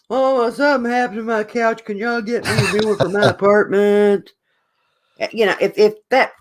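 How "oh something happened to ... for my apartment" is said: higher, put-on pleading student voice; whining kid calling mom. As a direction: imitate a helpless kid begging mom. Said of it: "you're gonna call mom and".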